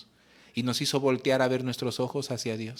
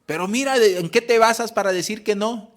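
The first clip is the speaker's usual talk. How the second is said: challenging; 7 semitones higher, 6 dB louder than usual. Retold or imitated. Neighbour challenges the mother.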